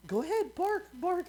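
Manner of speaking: high sing-song coaxing. Retold or imitated. Mom urging her kid to bark, high coaxing. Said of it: "she's like".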